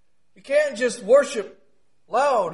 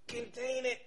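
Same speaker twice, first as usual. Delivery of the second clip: ordinary talk, nothing marked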